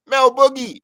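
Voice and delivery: high, loud, thrilled name drop; excited reveal. Real, not acted. Storyteller revealing who was spinning inside, excited reveal. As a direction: announce the name like a big surprise.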